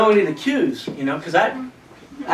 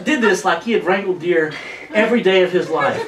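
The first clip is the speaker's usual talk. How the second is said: normal talking voice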